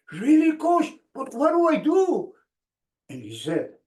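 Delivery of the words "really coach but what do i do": put-on eager young player. Young player asking coach, retold.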